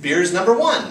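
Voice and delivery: loud enthusiastic declaration; eager answer. Retold or imitated. Hitchhiker telling his host he loves beer, eager. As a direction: proclaim it with joy.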